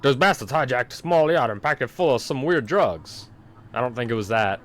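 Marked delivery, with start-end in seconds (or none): 0.0-3.3 animated put-on accent for a ship's captain; swinging pitch, trying out an accented captain voice; Captain Drake. Captain radioing the lighthouse about a hijacked yacht, put-on accent. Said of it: "how did Captain Drake sound again?".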